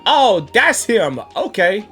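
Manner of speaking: ordinary talk, nothing marked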